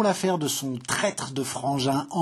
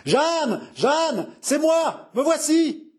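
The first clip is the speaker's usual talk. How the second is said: high-pitched frantic calling; 12 semitones higher, much slower than usual. A narrator's reading. Returning husband calling to Jeanne, frantic. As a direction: call out high to the beloved from afar. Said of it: "une voix frénétique".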